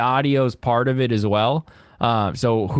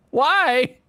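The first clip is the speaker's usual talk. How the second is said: normal talking voice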